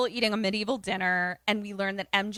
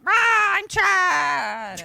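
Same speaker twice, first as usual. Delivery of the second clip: high, drawn-out hammed-up shout; mock Chad roar; 10 semitones higher, 8 dB louder, much slower than usual. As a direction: roar it slowly like a ridiculous show-off. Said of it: "like, just being like".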